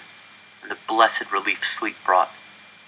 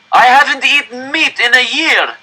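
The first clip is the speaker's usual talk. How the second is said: outraged cry; 6 dB louder than usual. Acted. Starving man crying out at the director, outraged. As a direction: hurl it at the stage in disbelief.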